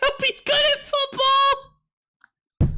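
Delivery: can barely speak for laughing; squeaky laughing voice; laughing hard. Real, not acted. Laughing hard at Kyle's donation.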